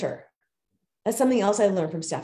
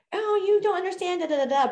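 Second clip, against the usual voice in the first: mock defensive whine; 11 semitones higher than usual. Mock dismissive reaction quoted.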